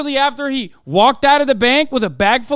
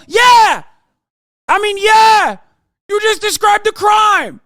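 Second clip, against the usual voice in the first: mock-outraged yelling, much higher and louder; sarcastic shout; 9 semitones higher, 5 dB louder than usual. Streamer mocking a news segment, sarcastic shout. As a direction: bellow it like the obvious punchline.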